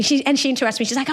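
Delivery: ordinary talk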